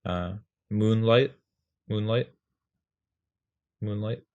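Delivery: moonlight, slow, both syllables leaned on; rhythmic moonlight. Shown as a demonstration. Teacher sounding out moonlight's rhythm. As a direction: say moonlight with even weight. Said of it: "that has a rhythm to it".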